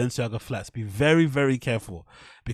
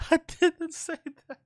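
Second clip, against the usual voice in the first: parroting in a squeaky high voice; high mock sing-song. Mocking replay of the accusation.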